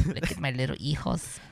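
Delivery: soft, lightly voiced Mexican señora. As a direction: murmur like a doting old señora. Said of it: "my Mexican, uh, señora voice".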